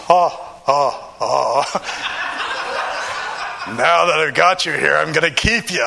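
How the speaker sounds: a touch of a gloating giant captor, laughing; mock-sinister chuckle, then the threat; menacing laugh. Big officer taunting his trapped prisoner, mock-menacing laugh.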